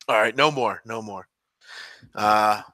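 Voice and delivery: usual speaking voice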